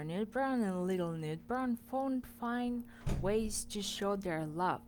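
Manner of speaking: ordinary delivery, nothing marked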